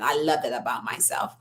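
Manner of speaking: a soft, private whisper to herself. Real, not acted. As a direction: whisper it like a secret you cherish. Self-affirmation repeated to herself.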